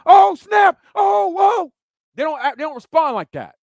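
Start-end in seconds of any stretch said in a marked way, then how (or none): 0.1-1.7 high, loud mock alarm; hammed-up "oh snap" of a boxer pretending to care; mock shocked yelp. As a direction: yelp in pretend surprise at the knockdown. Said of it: "they don't go".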